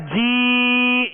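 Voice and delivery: slow, drawn-out teaching line; stretched demo. Shown as a demonstration. Teacher shows students where to stretch, slowly. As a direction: lengthen the vowel to show it. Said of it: "यहां खींचेंगे".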